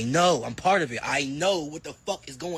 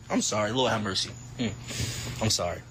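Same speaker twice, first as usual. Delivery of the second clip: mock apology; 9 semitones lower than usual. Apology repeated.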